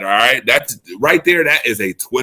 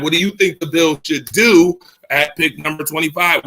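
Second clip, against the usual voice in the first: usual speaking voice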